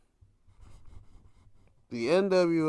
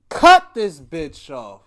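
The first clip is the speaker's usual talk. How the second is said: much louder, slowed-down demand; mock outburst; 13 dB louder, slower than usual. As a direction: bark it slow like you're fed up with the show. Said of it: "I was like".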